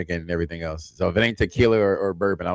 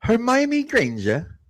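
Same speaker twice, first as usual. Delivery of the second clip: much higher, slow schoolgirl voice; a brief, stretched-out girlish British impression; Hermione Granger; 14 semitones higher, much slower than usual. Cohost showing the only impression he can do, a high schoolgirl voice. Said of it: "I can only do".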